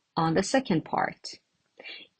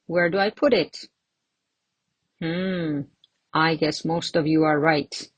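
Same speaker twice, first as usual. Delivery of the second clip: usual speaking voice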